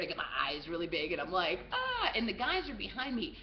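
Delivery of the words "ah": short high fake-frightened gasp; pretend scared. Faking fright at the security guards, pretend scared. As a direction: let out a high mock-startled "ah". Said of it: "I pretend to be scared".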